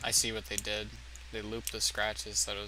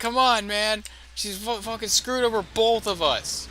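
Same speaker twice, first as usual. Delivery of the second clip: mock yell; 9 semitones higher, 7 dB louder than usual. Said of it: "She's like".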